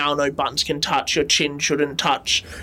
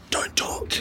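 whisper; 7 dB quieter than usual. Acted.

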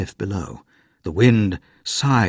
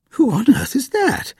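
put-on much higher, startled old woman; Granny voice. A narrator's reading. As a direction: ask it in surprise like a granny.

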